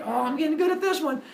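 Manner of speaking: high-pitched mock delight; delighted boast. Epimetheus getting good at making creatures, delighted. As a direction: gush it high, pleased with yourself.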